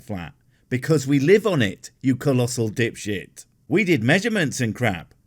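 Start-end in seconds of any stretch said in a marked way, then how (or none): none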